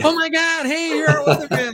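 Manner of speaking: high-pitched gushing fan voice; put-on, over-excited high voice; starstruck fan impression. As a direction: squeal it like a fan spotting a celebrity. Imitating excited small-market fans.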